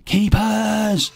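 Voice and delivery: high, loud yell; yelled at top of voice. Retold or imitated. Teammate yelling across the empty dance floor. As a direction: bellow it across the room. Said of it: "at the top of his voice".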